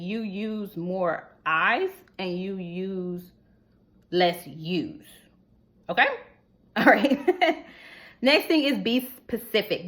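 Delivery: ordinary talk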